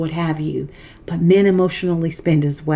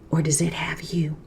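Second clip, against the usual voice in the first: dramatic whisper; 2 semitones lower, 7 dB quieter than usual. Acted. Host whispers a pointed question to struggling men. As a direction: drop to a sudden whisper for the punchline. Repeated rhetorical challenge.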